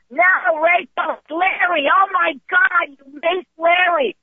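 high-pitched imitation of an alarmed girlfriend; mock horrified outcry. Girlfriend to roommate who maced Larry, crying out in mock horror. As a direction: wail it high, dragging out the last words.

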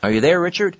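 calling out. Real, not acted. Host checks whether guest Richard is still there, calling out.

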